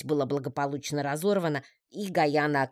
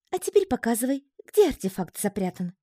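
амазонка; 8 semitones higher than usual. A narrator's reading. Амазонка командует паучку.

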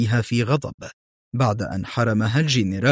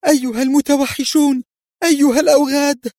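high, piercing cry of terror; terrified scream; 4 dB louder, faster than usual. A narrator's reading. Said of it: "التي صرخت في رعب". (صرخة رعب حادة)

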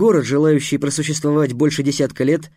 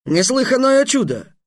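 much higher amazed exclamation; 10 semitones higher than usual. Acted. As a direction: marvel at it, voice up high. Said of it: "Удивлённо сказал".

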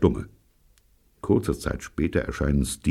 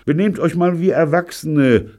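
loud, high-pitched protest; exasperated raised voice; protesting under blows; 7 dB louder than usual. A narrator's reading. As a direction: call out while being punched. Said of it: "sage ich zwischen den Hieben".

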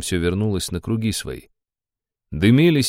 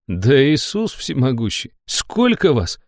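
indignant; 6 semitones higher, slower than usual. A narrator's reading. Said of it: "возмутился я".

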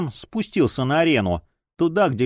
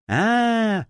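put-on whimpering like a child; 9 semitones higher than usual. A narrator's reading. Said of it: "захныкал он, как ребёнок".

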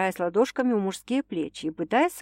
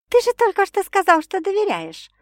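high, louder mock outrage with a smile; indignant, hiding a smile; 12 semitones higher, 6 dB louder than usual. A narrator's reading. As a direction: protest while fighting back a grin. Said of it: "Не сумев скрыть улыбку, возмутилась".

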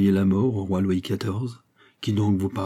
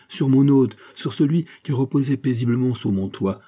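high, aggrieved reproach; indignant accusation; 4 semitones higher than usual. Acted. Fouquet accusing Aramis of betraying his guest, indignant. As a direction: denounce the betrayal, voice raised.

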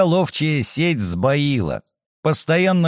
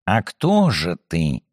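gentle; 7 semitones lower than usual. Acted. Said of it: "мягко спросил Сумароков".

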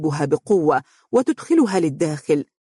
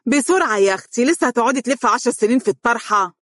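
impatient yelling; 6 semitones higher, faster than usual. A narrator's reading. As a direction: hurry her with a raised voice. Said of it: "زوجة أبيها تصرخ".

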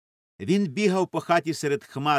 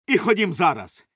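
loud, higher-pitched order; raised urgent command; shouting; 5 semitones higher, 5 dB louder than usual. A narrator's reading. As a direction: shout to get moving now. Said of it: "кричав він".

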